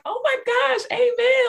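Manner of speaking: high squealing oh my gosh, amen; excited cheer. Retold or imitated. Women on the live cheering a first prayer, squealing high. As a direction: cheer her on with a delighted squeal.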